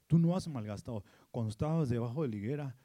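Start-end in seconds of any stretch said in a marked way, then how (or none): 1.3-2.8 lower, slowing on yo te vi; tender divine voice. Acted. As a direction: let the words fall gently. God's words, dropping low. (voz tierna y grave)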